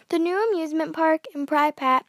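usual speaking voice

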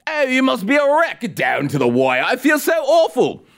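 high, hammed-up British accent, fake concern; mock British pity; mock British sympathy. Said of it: "That was like a John Oliver accent".

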